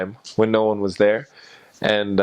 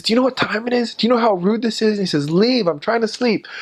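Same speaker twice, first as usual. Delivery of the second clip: retold scream; 12 semitones higher than usual. Woken neighbor screaming at missionaries to leave. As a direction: rail at the intruders as if woken from sleep. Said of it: "he just screamed at us".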